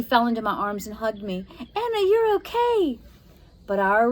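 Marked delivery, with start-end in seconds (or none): 1.6-3.0 high, joyful relief; overjoyed to see her; relieved. A narrator's reading. Josie greets her sister saved from the spell.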